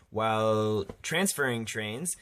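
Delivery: ordinary talk, nothing marked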